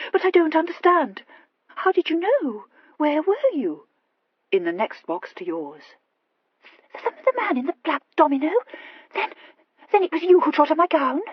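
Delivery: high, urgent, stammered questions; bewildered Horatia. Acted. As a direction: press him in breathless bewilderment.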